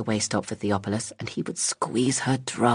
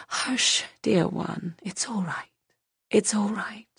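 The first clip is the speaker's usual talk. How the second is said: soft comforting whisper; 9 semitones higher, 4 dB quieter, slower than usual. Acted. Woman comforts sobbing child.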